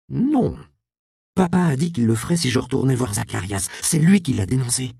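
high, loud outburst against her father; angry accusation. Acted. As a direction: throw the blame at her father. Alice accuse son père, en colère. (la fille accuse son père avec colère)

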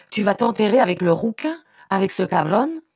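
high, loud, indignant questions; outraged yelling. A narrator's reading. Brother berates his sister over her fiancé, outraged yelling. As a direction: throw it at her in disbelief and fury. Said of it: "se mit-il à hurler".

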